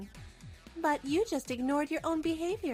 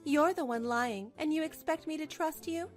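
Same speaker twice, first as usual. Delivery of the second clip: ordinary delivery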